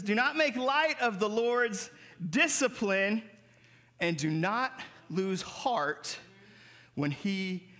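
tender scripture reading, fading out; slow scripture reading. Acted. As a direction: read the verse gently to the church.

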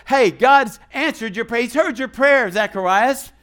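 excited announcement. Acted. Preacher voicing the angel's joyful news to Zacharias. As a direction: shout the good news.

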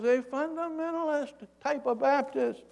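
high-pitched mock call from above; thin acted call back; yelling down. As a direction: imitate a distant holler without much volume. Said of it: "He yells down".

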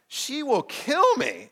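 hammed-up fear of the wife; mock panic. Telling Chuck his wife will kill him, mock panic.

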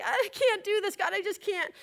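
high-pitched panicky whine; acted tearful plea to God; freaking out. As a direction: squeal it high, as if falling apart. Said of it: "freaking out".

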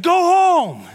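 high loud shout of go home; yelling at dog. Retold or imitated. Pastor yelling at the charging dog to go home. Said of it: "I was like".

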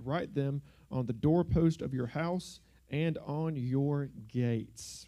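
ordinary delivery

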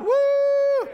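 high loud whoop of a crowd; whooping cheer. Acted.

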